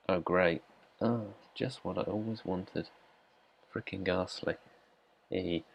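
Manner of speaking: flat sarcastic "oh great"; dry mock delight, quiet; faking delight at gift. Real, not acted. Sarcastic reaction to poor rewards.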